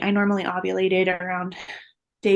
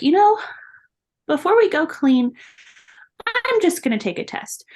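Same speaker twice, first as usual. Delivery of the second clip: excited thought; 7 semitones higher than usual. Retold or imitated. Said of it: "And I was like".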